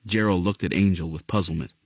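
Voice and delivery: usual speaking voice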